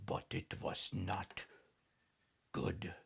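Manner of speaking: hushed serious turn; suddenly serious. Acted. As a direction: drop the game, turn solemn. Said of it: "became suddenly serious".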